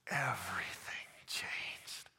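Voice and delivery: slow, low whisper. Acted. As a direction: drop to an awed whisper. Climax of the healing story.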